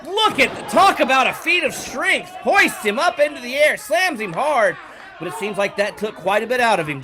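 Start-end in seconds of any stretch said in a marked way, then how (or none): 0.0-4.8 higher and louder hype call; excited commentator. Acted. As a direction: sell the power move to the crowd. Commentator hyping a lift and slam.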